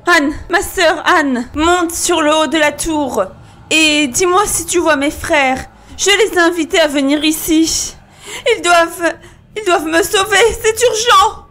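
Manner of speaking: high, anxious call, mounting to desperation; urgent plea. Acted. Wife asking her sister to watch for rescuers, urgently. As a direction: implore with growing urgency.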